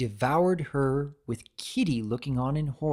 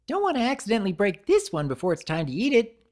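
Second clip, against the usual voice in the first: high, louder and fast, giggling; giggly creator; 7 semitones higher, 5 dB louder, faster than usual. A narrator's reading. Creator taunts the cookies before eating, fast and giggly. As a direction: rattle it off with a giggle. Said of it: "she said with a giggle".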